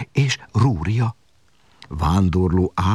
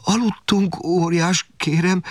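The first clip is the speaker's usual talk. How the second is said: high, halting stutter; frightened stammering; 12 semitones higher, slower than usual. A narrator's reading. Martin explaining himself to the giant, scared high stammer. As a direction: stumble over each word, pitched high. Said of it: "dadogta Martin".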